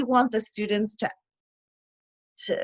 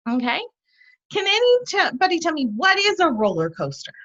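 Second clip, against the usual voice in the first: teacher demo; 3 semitones higher than usual. Shown as a demonstration. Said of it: "and you can say".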